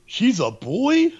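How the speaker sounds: incredulous, very high Brooklyn voice; Brooklyn guy. Retold or imitated. Brooklyn guy seeing Angela naked, mock-shocked Brooklyn accent. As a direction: stretch it out in loud Brooklyn disbelief. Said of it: "the Brooklyn guy is".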